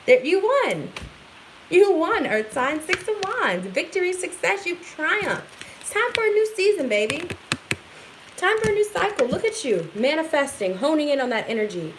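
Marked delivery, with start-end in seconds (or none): 0.0-7.3 excited. Real, not acted. Tarot reader cheering earth signs on their victory, excited. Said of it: "I get excited". 8.4-9.9 excited. Real, not acted. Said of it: "I get excited".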